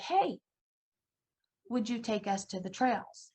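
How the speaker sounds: usual speaking voice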